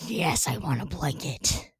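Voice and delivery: cosy relieved acceptance; eager yes. Retold or imitated. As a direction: sink into it, grateful.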